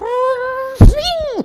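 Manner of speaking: high, loud steam-whistle squeal; piercing mock pressure-burst noise; cartoon blow-top noise. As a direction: imitate a cartoon head exploding. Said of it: "literally blow their top".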